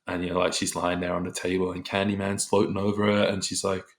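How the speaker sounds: normal talking voice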